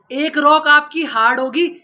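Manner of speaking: ordinary talk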